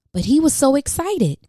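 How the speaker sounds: ordinary delivery, nothing marked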